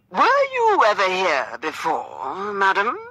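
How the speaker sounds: slow, loud theatrical questioning; actor's voice. A narrator's reading. Gentleman quizzing his dance partner, in an actor's voice. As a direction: declaim it grandly, stretching the words. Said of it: "continuing with his actor's voice".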